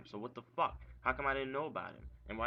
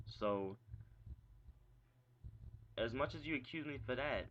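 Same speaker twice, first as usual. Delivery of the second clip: ordinary talk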